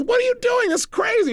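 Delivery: high-pitched imitation of amused disbelief; mock giddy protest. Dripping woman protesting to the seminar leader, mock giddy protest. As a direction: squeal it as if cracking up. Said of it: "she started laughing".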